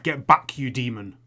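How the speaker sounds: loud, forceful acted shout; sudden burst of volume, fending off an attacker; Samuel shouting. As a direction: shout it to drive off a demon. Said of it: "you hear him shout".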